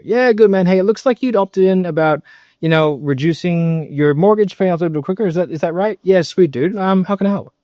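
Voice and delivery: high, cheerful phone patter; happy, confident. Shown as a demonstration. Sales coach demonstrating a call with a prospect, happy and confident. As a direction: sound like you love this job. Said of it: "sound like you enjoy your job".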